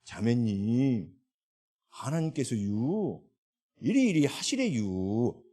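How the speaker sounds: slow Chungcheong drawl with stretched endings; imitated Chungcheong speech, very unhurried; Chungcheong dialect. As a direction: put on a drawn-out Chungcheong accent. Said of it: "갑자기 충청도 말로 전달을 하니까".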